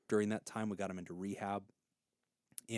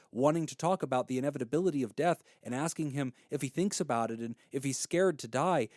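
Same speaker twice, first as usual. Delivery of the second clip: ordinary delivery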